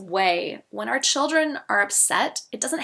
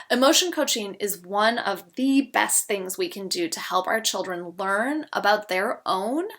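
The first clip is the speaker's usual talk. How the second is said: ordinary delivery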